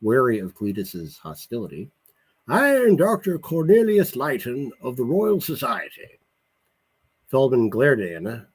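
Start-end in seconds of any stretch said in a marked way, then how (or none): 2.5-6.2 put-on Leighton introducing himself in an odd English accent; formal self-introduction in a higher, unusual English accent. Leighton introduces himself to Feldman in an unusual English accent. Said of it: "in an unusual English accent".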